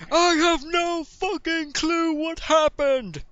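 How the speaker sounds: high, loud, drawn-out yell; shouting back. Acted. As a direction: yell it slowly across the arena. Azamok answers a shout across arena.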